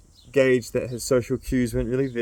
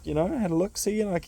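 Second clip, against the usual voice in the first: a hint of Jam's voice; 7 semitones higher than usual. Jam answers, voiced.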